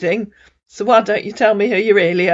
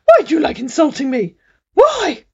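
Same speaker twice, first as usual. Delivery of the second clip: pitched-up indignant man's voice; scammer voice; 7 semitones higher than usual. A narrator's reading. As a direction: complain like a wounded scammer. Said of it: "said Denise".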